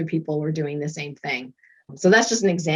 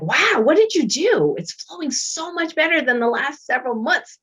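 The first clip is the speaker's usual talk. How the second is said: amazed therapist; 9 semitones higher than usual. Retold or imitated. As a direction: play the therapist stunned by the change. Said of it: "She was like".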